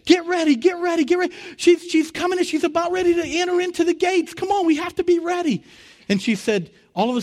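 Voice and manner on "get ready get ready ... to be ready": very high, loud, hurried excitement; rally the others, she's at the gates; urgent heavenly crowd. Retold or imitated. People at the gates urge each other on, very high and urgent.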